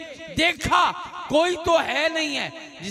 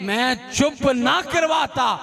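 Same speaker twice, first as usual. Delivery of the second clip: proclaiming the Imam's words; 7 semitones higher than usual. Retold or imitated. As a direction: hold the words out to the crowd. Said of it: "कायनात के इमाम फरमाते हैं".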